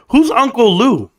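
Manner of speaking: ordinary delivery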